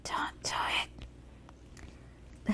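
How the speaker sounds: slow unvoiced whisper in the ear. Devil whispering into a worshipper's ear, slow tempting whisper. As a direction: breathe it slowly into their ear like a tempter. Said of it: "there I am whispering in their ear saying".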